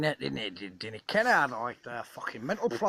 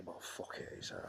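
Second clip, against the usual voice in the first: whispered aside; 14 dB quieter than usual. Real, not acted. Muttered aside mid-talk.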